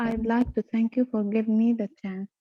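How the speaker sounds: ordinary delivery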